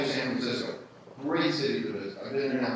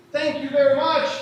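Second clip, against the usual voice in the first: mock polite German; 11 semitones higher, 8 dB louder than usual. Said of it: "German commanders who said".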